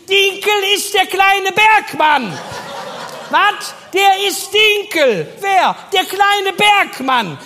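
very high, loud, slow shouting; Helga from the back. Retold or imitated. Helga calls from the back, high and drawn out. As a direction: holler it from the back room. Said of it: "Helga von hinten".

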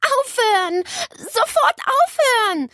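high, loud and indignant; annoyed yell at a noisy pet; cross shout. A narrator's reading. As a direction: shout it crossly to make the pest stop. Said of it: "rief Nele sauer".

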